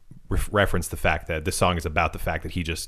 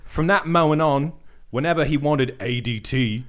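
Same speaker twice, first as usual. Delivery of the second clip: higher-pitched put-on British narration; wandering British accent; 5 semitones higher than usual. Host finishes the paragraph for friends, wandering British accent. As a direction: read on in a shaky imitation British accent. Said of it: "I think I changed, like, four times".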